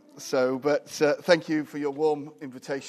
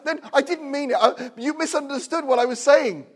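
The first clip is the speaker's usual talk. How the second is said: higher, flustered pleading voice; mock backpedalling pastor; 10 semitones higher, 6 dB louder than usual. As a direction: play a panicked pastor taking it back. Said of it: "not many pastors would do that".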